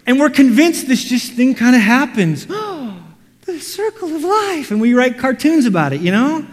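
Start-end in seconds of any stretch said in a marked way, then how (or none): 2.5-4.7 high, breathless fake awe after a gasp; mock wonder with gasp. Preacher mocking naive awe, high and gushing. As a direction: gasp and gush like it's a revelation.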